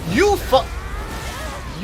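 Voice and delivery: sudden high furious yell at the enemy; furious shout. Real, not acted. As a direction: explode with rage mid-insult. Raging at a game enemy.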